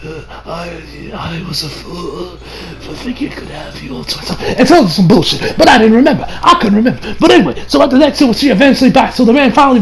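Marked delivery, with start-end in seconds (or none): 0.0-4.4 very soft, faint and slow last words; dying whisper to Alice; weak dying voice. Retold or imitated. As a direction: fade out like a man breathing his last.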